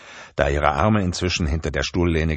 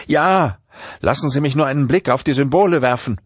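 much higher, urgent pleading; drowning professor begging his captor, higher; frantic Langton; 7 semitones higher than usual. A narrator's reading. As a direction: plead fast to be let out.

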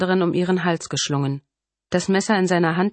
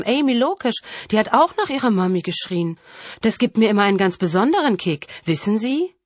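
higher, lilting, mocking villain voice; gloating killer; 5 semitones higher, 3 dB louder than usual. Killer taunts the mother about crying victims, gloating. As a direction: taunt with mock sweetness and relish.

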